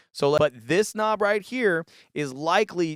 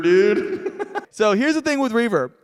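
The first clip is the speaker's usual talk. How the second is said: laughing, louder and higher; 6 semitones higher, 6 dB louder than usual. Real, not acted. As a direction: laugh through the words.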